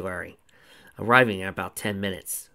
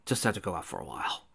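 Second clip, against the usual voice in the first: evasive; 4 dB quieter than usual. A narrator's reading. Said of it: "he answered evasively".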